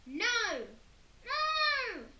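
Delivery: very high, loud howl. A narrator's reading. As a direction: howl in despair. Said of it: "howled Peter".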